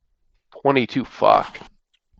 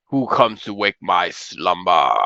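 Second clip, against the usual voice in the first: slow, low dragon rumble; grinding stone statue; 5 semitones lower, slower than usual. Acted. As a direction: drag each word out like stone grinding. GM repeats dragon line.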